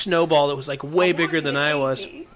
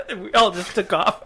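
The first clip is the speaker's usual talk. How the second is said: ordinary delivery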